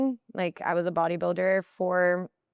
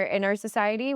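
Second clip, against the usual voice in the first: ordinary delivery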